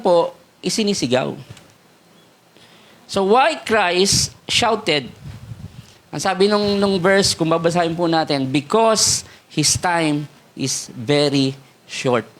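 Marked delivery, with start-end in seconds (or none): 3.1-5.1 slow rhetorical question. Acted. 8.5-12.3 slow solemn reading. Acted. Slow scripture quote.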